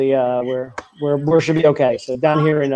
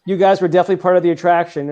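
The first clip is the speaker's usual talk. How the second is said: usual speaking voice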